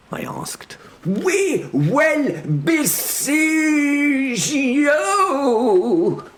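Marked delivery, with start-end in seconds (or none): 1.0-6.2 put-on very high, drawn-out accented shout; raging foreign herald. Herald threatens a siege, high drawn-out shout. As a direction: stretch the threat into a shrieked proclamation.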